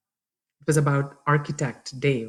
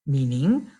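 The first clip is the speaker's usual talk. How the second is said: ordinary delivery, nothing marked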